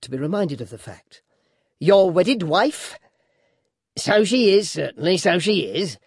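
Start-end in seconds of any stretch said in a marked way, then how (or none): 1.8-6.0 sly, theatrical dwarf; dwarf's mock agreement. A narrator's reading. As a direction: humour her with exaggerated assent. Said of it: "observed the dwarf".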